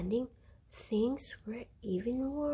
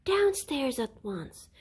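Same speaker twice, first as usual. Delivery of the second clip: mother's loud, raised bellow; bellowed order; 5 semitones higher, 7 dB louder than usual. A narrator's reading. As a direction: bark the order down the stairs, louder. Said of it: "bellowed Missus Large".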